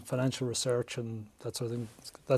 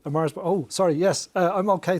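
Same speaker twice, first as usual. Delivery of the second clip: flustered surprise; 5 semitones higher, 6 dB louder than usual. Retold or imitated. Flustered shop reply.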